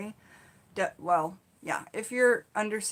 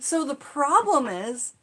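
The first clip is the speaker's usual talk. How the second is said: usual speaking voice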